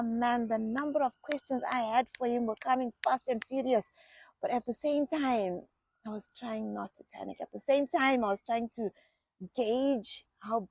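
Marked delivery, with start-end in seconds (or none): none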